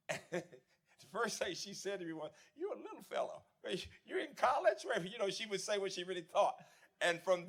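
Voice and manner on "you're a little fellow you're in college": high, teasing imitation of a bold teacher; a light take on her big voice sizing him up; booming Southern teacher. Teacher teasing the small freshman, high and playful. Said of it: "a booming voice, a rich Southern accent".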